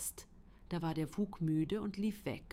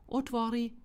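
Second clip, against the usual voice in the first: high, loud call at the door; storybook wolf's raised demand; wolf demanding entry; 6 semitones higher, 6 dB louder than usual. A narrator's reading. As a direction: call out to be let in. Wolf calls at second door.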